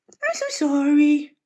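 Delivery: high, slow cooing sorry; pet-voice sorry. Real, not acted. Apologising to the cat in high, slow baby talk.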